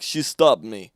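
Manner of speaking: imitated Russian accent, low and loud; Victor. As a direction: attempt a straighter Russian accent. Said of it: "how do you do Russian?".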